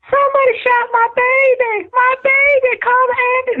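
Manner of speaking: very high, sustained mock wail. Caller imitates bystander after shooting, high hammed-up wailing. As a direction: imitate a frantic cry for help. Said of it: "I don't wanna do a very stereotypical voice".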